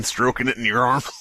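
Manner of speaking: ordinary talk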